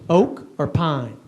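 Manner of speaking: lightly voiced, slow lumber guy; a hint of a laconic store clerk, drawn out. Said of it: "He goes".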